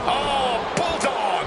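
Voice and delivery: excited commentator call. Real, not acted. Live wrestling play-by-play call.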